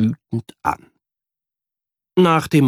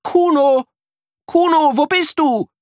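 calling out; 4 dB louder than usual. A narrator's reading. Boy calls missing friend.

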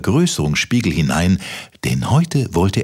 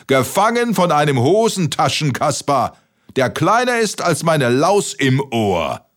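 hooting derision; 9 semitones higher than usual. A narrator's reading. Giant ridiculing pocket-sized Kaspar, hooting. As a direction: keep roaring in high-pitched disbelief. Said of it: "gröhlte der Riese in den Himmel".

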